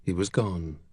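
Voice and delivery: usual speaking voice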